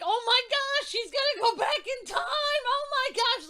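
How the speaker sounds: high, loud, excited shriek; shouting in breathless excitement; frantic realization. Retold or imitated. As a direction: relive the shock at full volume. Reliving her frantic realization at climax.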